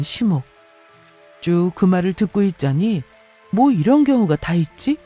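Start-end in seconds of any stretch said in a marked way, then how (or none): none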